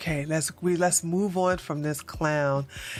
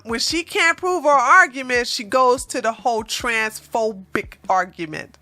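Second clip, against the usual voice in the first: ordinary talk, nothing marked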